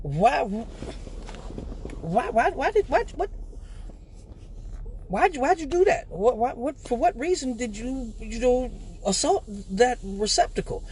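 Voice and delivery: high, soft bewildered stammer; mock disbelief, stumbling over why; baffled brothers. Brothers gaping after the porta potty kick, high baffled stammer. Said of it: "looking at me like".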